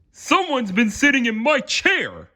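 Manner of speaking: high, put-on Papa Bear voice, a touch alarmed. A narrator's reading. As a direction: play the father bear finding his door open. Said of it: "he exclaimed".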